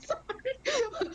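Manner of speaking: high, giggly apology; real laughter. Real, not acted. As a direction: squeeze the word out through laughter. Laughing at the hot remark.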